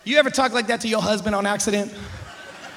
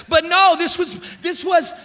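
higher, slow objection; balking protest, higher pitched; acted protest; 6 semitones higher, 4 dB louder, slower than usual. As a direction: object in a raised, drawn-out voice. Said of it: "and be like".

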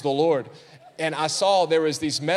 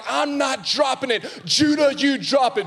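put-on stubborn kids arguing; 10 semitones higher, 5 dB louder than usual. Sons arguing over the ball.

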